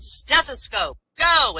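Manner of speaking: high, loud starting order; shouted command. Acted. Doctor commanding his dog in the finals, shouted order.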